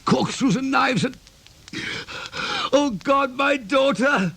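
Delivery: high, strained cry of gut pain; doubled over with pain. Acted. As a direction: gasp through a stomach cramp. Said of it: "My guts are in torment".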